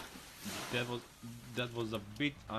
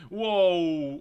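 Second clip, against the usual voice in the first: loud, higher-pitched burst of shock; real startled outburst; startled shout; 6 semitones higher, 11 dB louder than usual. Real, not acted. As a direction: blurt it out in loud alarm. Reacting to sudden fuel spill.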